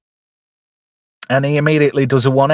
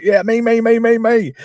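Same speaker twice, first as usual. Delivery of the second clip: eager volunteers; 11 semitones higher than usual. Retold or imitated. Recruits eagerly volunteering to fight.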